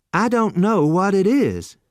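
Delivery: put-on young man confessing ignorance to waiter; innocent youth. A narrator's reading. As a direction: guileless and honest. Lexington innocent.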